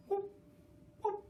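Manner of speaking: short high whoop sounds. Acted. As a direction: make the chicken's funny noise. Chicken's sound in story.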